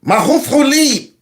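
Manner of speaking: high, mock Somali accent; mocking foreign-language mimicry. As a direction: parrot the Somali in an exaggerated high voice. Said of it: "Y'all hear that?".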